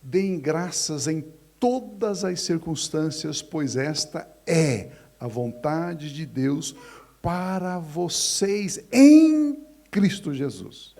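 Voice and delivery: slow, solemn reading of the verse; slow scripture reading. Acted. Pastor quoting Paul to the church, slow and solemn. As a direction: read Paul's words slowly, rising to loud at the end. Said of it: "ele diz assim".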